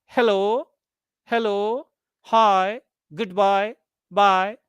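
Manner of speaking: each word lifted at the end, "Hello" to "bye"; rising tone greetings. Shown as a demonstration. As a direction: separate greetings, each curling upward from "Hello" on. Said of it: "normally spoken in rising tone".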